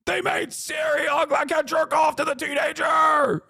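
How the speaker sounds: high, slow whiny mock shout; mocking angry gamers. Mocking gamers who rage that Siri was made ugly, in a whiny high shout. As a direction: sneer at the outraged fans in a shrill-pitched wail. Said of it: "these guys are like".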